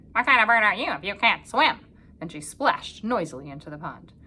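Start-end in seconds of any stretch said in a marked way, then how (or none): 0.1-1.8 taunting duck, loud and nasal-free. Acted. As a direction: sneer it like a proud duck.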